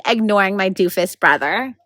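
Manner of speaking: ordinary delivery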